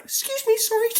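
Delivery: high squeaky voice apologising to traffic; polite taxi driver. Retold or imitated. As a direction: pitch way up, hammed-up politeness. Taxi driver dodging tanks.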